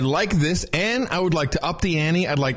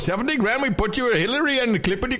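put-on high, fast Pakistani accent salesman; hammed-up Pakistani accent pitch; 9 semitones higher than usual. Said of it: "Can't you do it with the Pakistani accent".

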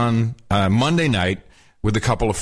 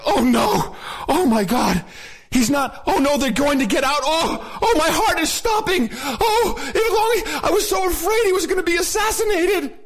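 rising higher and higher into hammed-up panic; mock panic; 13 semitones higher than usual. Mocking a friend fearing the president's assassination, hammed-up panic. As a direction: ham up her breathless fear, climbing in pitch. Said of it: "I was like".

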